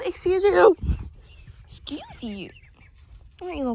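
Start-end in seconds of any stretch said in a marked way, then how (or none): 0.0-0.7 loud, high mock offense; sassy scolding. Real, not acted. Chicken snubs her. 1.6-2.5 whispered mock offense. Real, not acted. Repeats under her breath.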